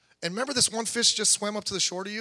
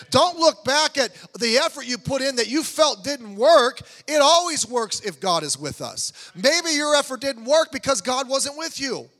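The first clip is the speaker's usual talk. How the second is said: loud, slow, sing-song sermon cadence; full-force preaching; 4 semitones higher, 7 dB louder, slower than usual. Acted. Pastor urging his congregation, loud slow preaching. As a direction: preach it out, pressing each phrase.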